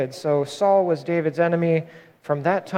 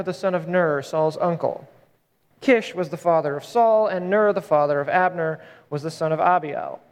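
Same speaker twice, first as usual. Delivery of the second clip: ordinary delivery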